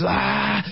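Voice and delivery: high-pitched drawn-out "aah" war cry; battle yell. Acted. Comedian re-enacts himself shooting zombies, high battle yell. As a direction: yell out as if firing wildly. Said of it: "empiezo a disparar como lo que es".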